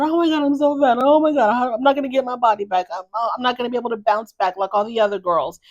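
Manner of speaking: high, fast, mocking whine about her body; mocking whiny wife. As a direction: ham up the self-pity, pitched high. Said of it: "she's probably going".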